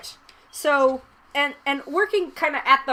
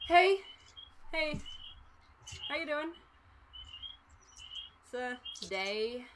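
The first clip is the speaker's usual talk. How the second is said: tired bored cashier; 4 semitones higher, 10 dB quieter than usual. Acted. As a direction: greet them like you've given up. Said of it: "he kinda just sort of like".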